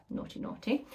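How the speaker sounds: playful whispered tut-tut; teasing whisper. Real, not acted. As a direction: whisper it like a cheeky scolding. Teasing aside about teacher affair.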